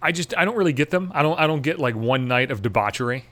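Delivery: ordinary talk, nothing marked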